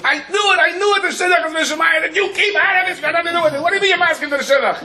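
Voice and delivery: very high-pitched frantic shrieking; hammed-up falsetto freak-out; out of his mind. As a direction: shriek it hysterically at the top of your range. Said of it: "he's going out of his mind".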